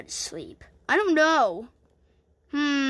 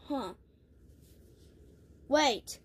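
ordinary talk, nothing marked

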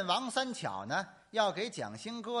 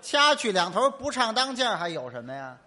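louder, higher indignant repeat; mock exasperated; 4 semitones higher, 8 dB louder than usual. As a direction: throw the absurd idea back, loud. Repeating absurd proposal indignantly.

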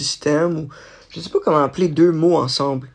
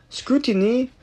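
"scrutiny" in a French accent, said skuteenee with a French t; Quebec French speaker's take on "scrutiny", rising; French-accented "scrutiny", syllables even; 8 semitones higher than usual. Shown as a demonstration. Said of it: "sans mon accent français".

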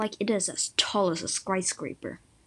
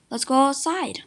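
raised, higher storybook shout; mild mock scream; 5 semitones higher, 5 dB louder than usual. A narrator's reading. Boy eager to play outside with his brother, mild scream. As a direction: call it out louder and higher, lightly acted. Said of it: "I screamed".

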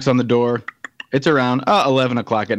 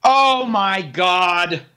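long, drawn-out, higher groan of disbelief; drawn-out mock dismay; 5 semitones higher, much slower than usual. Hearing that the prize is a QR code, drawn-out mock dismay. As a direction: stretch every word slowly in exasperation.

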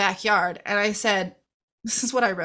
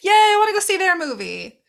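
mock excited cheer; 10 semitones higher, 5 dB louder than usual. Her own excited reaction.

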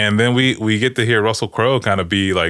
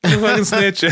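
mock indignant outburst; 11 semitones higher than usual. Acting out Zeus outing the snitch.

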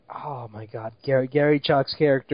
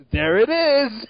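mock drunken exclaim; 6 semitones higher, slower than usual. Retelling Gary Chalk's Cool Cat moment, mock drunken exclaim. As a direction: blurt it like a tipsy cameo. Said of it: "exactly the same delivery".

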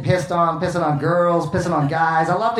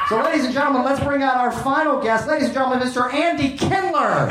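host announcing guest; 7 semitones higher than usual. Retold or imitated. Letterman introducing final guest.

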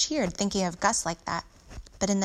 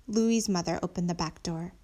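usual speaking voice

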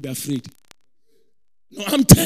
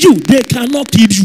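loud preacher's proclamation, each word driven home; booming, emphatic promise; forceful declaration; 12 dB louder than usual. Real, not acted. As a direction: shout it out to rouse the congregation. Repeats forcefully to draw an amen.

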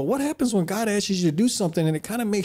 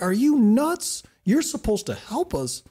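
high, drawn-out disbelief, easing down; incredulous protest; 4 semitones higher than usual. Retold or imitated. Israelites complaining to Moses about the bricks, incredulous. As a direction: act out indignant disbelief, starting high.

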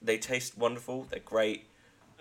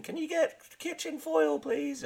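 mum voice. Retold or imitated. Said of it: "Oh, Mum, do we need anything?".

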